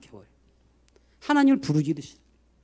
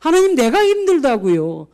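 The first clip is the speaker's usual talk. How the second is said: anguished protest; 6 semitones higher, 5 dB louder than usual. Retold or imitated. Complaining cry to God.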